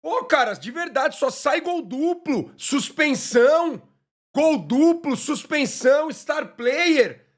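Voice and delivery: high, incredulous rant; exasperated high outburst. Real, not acted. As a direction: rant at the league in disbelief. Complaining about cards, very high.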